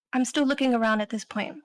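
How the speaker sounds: ordinary talk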